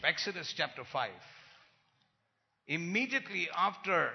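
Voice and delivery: ordinary talk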